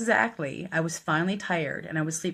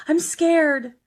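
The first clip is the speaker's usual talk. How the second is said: imitated scared plea; 10 semitones higher, 7 dB louder than usual. Billy grabs her arm, scared.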